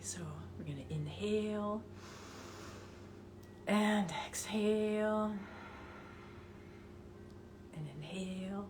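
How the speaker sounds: soft, hushed meditation voice; almost whispering the breath cues; guided breathing. Acted. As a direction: guide the breath gently and quietly.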